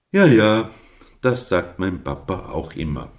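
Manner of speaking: loud grumbling ja ja; mock grumpy comeback. A narrator's reading. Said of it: "entgegnete Captain mürrisch".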